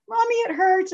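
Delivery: put-on high-pitched little-girl plaint; mock child crying. Hurt daughter coming to her mom, imitated high whine. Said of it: "and she's crying".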